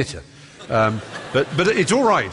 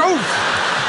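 mock exasperated mum.

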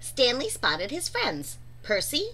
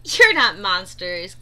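giggling; 4 semitones lower, 6 dB louder than usual. A narrator's reading. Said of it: "giggled Turtle".

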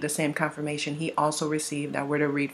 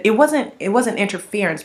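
normal talking voice

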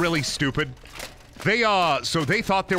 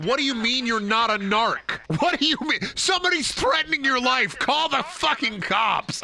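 exasperated outburst; 7 semitones higher than usual. Retold or imitated. Retelling baffled outburst at customer.

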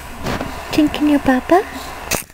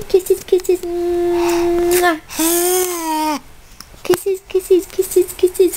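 high, repetitive singsong; 5 semitones higher, much slower than usual. Real, not acted. Mother asking kisses for the camera, singsong coaxing.

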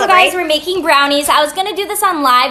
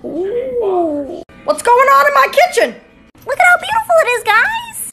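excited squeal; 10 semitones higher, slower than usual. Real, not acted. Excited reaction to dessert.

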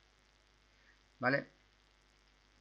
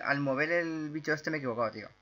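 normal talking voice

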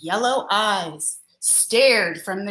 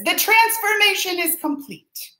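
higher grand announcing voice; friend's announcement; 9 semitones higher than usual. Acted. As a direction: declare it like a proud sidekick. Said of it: "His friend down here says".

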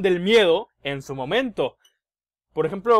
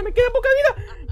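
much higher, laughing panicky whine; squealing laugh; 5 dB louder than usual. Real, not acted. Low health in horror game.

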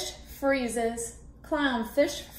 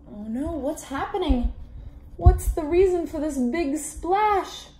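plain reading voice

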